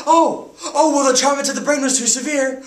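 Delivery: high, loud, bitter protest; anguished outrage. Acted. Teenager told to decide brother's death, anguished outrage. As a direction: cry out against the unfairness. Outraged at life-support decision.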